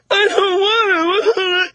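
high, slow, whiny sob; mock crying kid. Kids refusing to snorkel, a hammed-up high whiny wail. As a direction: wail it like a sulking child. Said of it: "And they're crying".

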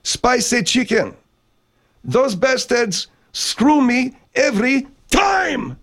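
very high voice, drawn-out words; high excitable character. Acted. Man griping about spicy chicken from Jack in the Box, high-pitched and aggrieved. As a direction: squeal it slowly, building to an indignant shout.

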